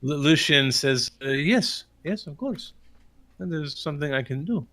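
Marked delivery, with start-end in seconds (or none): 1.2-4.6 put-on higher, accented cleric's voice; obliging priest. Priest agrees to bless the wine, higher accented voice. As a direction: agree readily as a helpful old-world priest.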